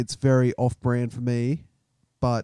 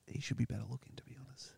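whispered aside; 2 semitones lower, 20 dB quieter, faster than usual. Retold or imitated. Muttered aside in retold thought.

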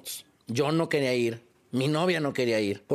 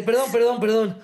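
frantic apology; 8 semitones higher, 6 dB louder than usual. Retold or imitated. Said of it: "me dice así de".

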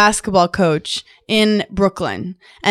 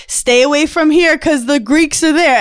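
mock dad laying down neighborhood rules; imitating dad; 6 semitones higher than usual. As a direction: warn emphatically like a street-wise father. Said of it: "My dad was like".

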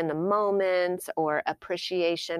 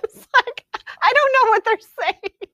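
laughing; 11 dB louder than usual. Retold or imitated. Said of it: "Ramel still needs the subtitles".

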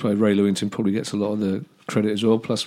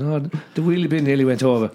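higher-pitched Irish cousin on the phone; imitating an Irish relative's accent; 5 semitones higher than usual. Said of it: "Was that your accent?".

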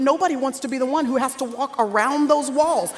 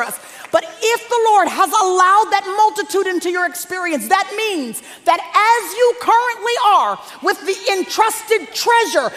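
raised, high-pitched preaching voice; impassioned preaching; 4 semitones higher than usual. Real, not acted. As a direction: preach it with rising force, punching key words. Preacher builds to full force.

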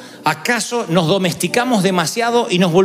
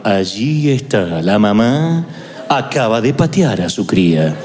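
much lower, slow, droning nature-documentary voice; monotone documentary narrator; 10 semitones lower, slower than usual. Retold or imitated. Preacher mocking Spanish documentary narrators, low droning monotone. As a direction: imitate a deadpan wildlife narrator. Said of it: "esa voz monosilábica".

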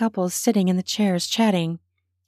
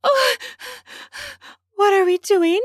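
breathless; 13 semitones higher than usual. A narrator's reading. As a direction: gasp out the words between breaths. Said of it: "I asked breathlessly".

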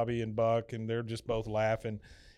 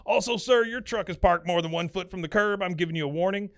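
raised, higher official warning; officious guard; 7 semitones higher, 4 dB louder than usual. Retold or imitated. Security guard warning Hank about his truck, officious. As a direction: issue it like a self-important guard.

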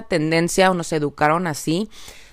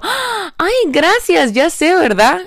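much higher, louder mock-diva thanks; exaggerated high delighted thank-you; cheeky confident thanks; 12 semitones higher, 5 dB louder, slower than usual. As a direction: gush playfully, ya sé verdad with a rising tease. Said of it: "y yo".

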